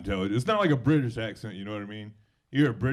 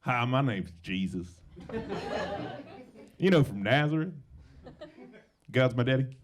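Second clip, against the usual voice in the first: put-on Southern accent. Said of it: "everything sounds better in a Southern accent".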